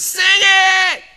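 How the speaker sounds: high, loud shouted demand; whole class yells at Bill to sing; class shouting. Retold or imitated.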